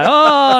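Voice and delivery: high, loud drawn-out "oh"; crowd's "oh". Retold or imitated. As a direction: imitate a scandalised crowd going "oh". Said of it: "all the people around him go".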